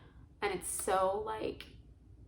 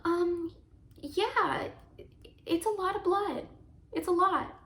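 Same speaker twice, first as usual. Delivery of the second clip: higher-pitched, louder, hesitant imitation of the nurse; nurse's worried answer; 9 semitones higher, 5 dB louder, slower than usual. Nurse answering the new mother about the blood loss, hesitant and high. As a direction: play the nurse breaking bad news gently. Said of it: "And the nurse is like".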